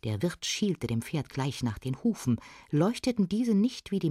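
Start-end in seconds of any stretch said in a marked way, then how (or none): none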